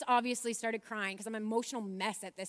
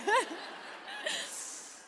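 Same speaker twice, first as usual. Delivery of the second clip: high laugh. Real, not acted. Laughing while retelling her husband's confusion, a high laugh. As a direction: laugh at the memory.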